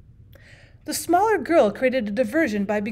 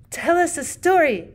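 higher, louder, slow eager plea; eager demand; 7 semitones higher, 5 dB louder than usual. A narrator's reading. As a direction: beg eagerly for a story. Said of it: "demanded the bigger of the small girls".

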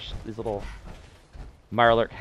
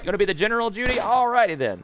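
imitating Preston; 10 semitones higher, faster than usual.

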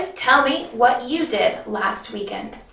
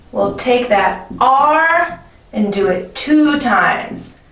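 ordinary talk, nothing marked

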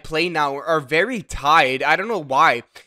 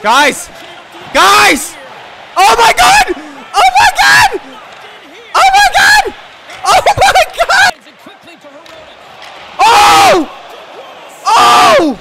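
shrieking jackpot winner; 9 dB louder, slower than usual. Real, not acted. Excited screaming opening.

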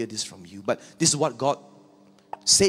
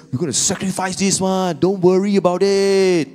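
much higher and louder, offhand; brazen Saul; 10 semitones higher, 8 dB louder than usual. Acted. Saul telling Samuel not to worry, brazen and loud. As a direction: brush it off boldly.

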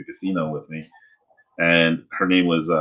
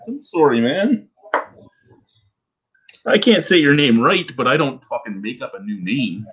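apologetic retort; 6 semitones higher than usual. Retold or imitated. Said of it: "And I'm like".

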